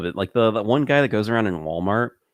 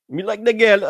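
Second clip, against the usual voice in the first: put-on Jamaican accent; 10 semitones higher, 4 dB louder than usual. Mimicking Jamaican speech.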